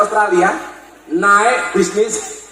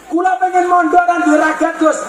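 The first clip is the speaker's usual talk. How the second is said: pleading student; 10 semitones higher than usual. Retold or imitated. Student asking Gus to board free, eager and high. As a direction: call out like an eager pupil. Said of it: "santri asking the cleric".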